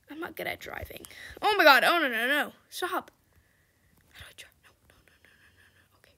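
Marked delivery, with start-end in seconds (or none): 0.1-1.0 whisper. Real, not acted. Aside while struggling to drive. 4.1-6.1 a string of no's breathed unvoiced; panicked whisper. Real, not acted. Panicking at the wheel.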